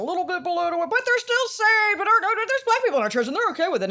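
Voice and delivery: mimicking coworker. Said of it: "telling me something about".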